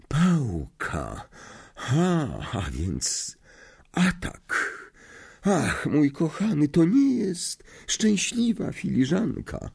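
slow, drawn-out fortune-teller voice; prophesy it, lingering on each word; a seer's ominous reading; mystic seer. A narrator's reading. Trelawney reads the tea cup.

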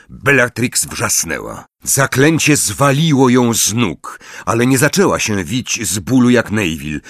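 plain reading voice

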